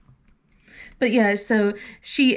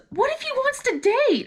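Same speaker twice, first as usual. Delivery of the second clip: put-on much higher, worried teen question; anxious daughter; 13 semitones higher than usual. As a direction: ask it like a nervous twelve-year-old. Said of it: "she goes".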